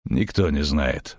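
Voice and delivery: low, slow grumble; grumbled. A narrator's reading. Witcher answers the question about the shapeshifter, grumbling. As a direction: grunt it low and unhurried. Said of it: "буркнул ведьмак".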